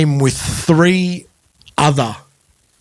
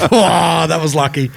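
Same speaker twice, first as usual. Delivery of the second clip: sarcastic; 4 semitones higher than usual. Retold or imitated. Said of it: "I was like".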